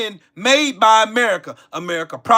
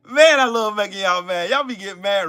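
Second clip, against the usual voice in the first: usual speaking voice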